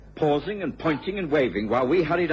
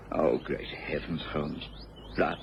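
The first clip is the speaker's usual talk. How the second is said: low, hushed horror at the body; horrified; 6 semitones lower, 5 dB quieter than usual. Acted. Watson finding the murdered girl, hushed and horrified. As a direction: breathe it out, stunned and appalled.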